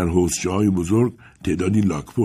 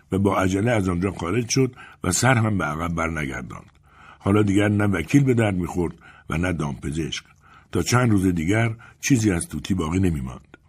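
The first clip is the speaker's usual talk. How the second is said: ordinary delivery, nothing marked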